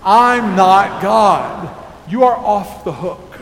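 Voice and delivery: slow, loud and emphatic declaration; said like we mean it. Shown as a demonstration. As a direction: declare it with full conviction. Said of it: "let's say it like we mean it".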